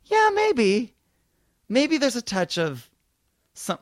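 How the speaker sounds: high, unsure musing voice; squinting doubt. Retold or imitated. Comedian studies his photo, high doubtful voice. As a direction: talk yourself into it, high and hesitant. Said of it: "you can kinda go".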